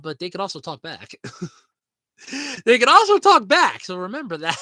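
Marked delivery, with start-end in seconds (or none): 2.6-3.8 loud and high, half laughing; loud warning. Real, not acted. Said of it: "warning repeated".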